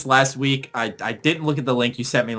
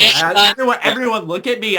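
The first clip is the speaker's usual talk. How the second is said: ordinary talk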